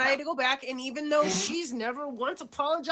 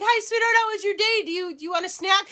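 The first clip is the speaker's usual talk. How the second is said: much higher, louder, sing-song greeting; sugary mom voice; 8 semitones higher, 4 dB louder than usual. Retold or imitated. Mother greeting her daughter home, high and sugary. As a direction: play the overly sweet eager mother. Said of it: "and I'm like".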